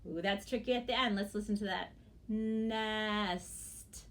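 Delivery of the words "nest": "Nest" stretched to a separate final t; slow and careful. Shown as a demonstration. Teacher shows the tricky ending, "Nest" said slowly. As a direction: draw out "Nest", every sound audible. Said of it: "say the word very carefully".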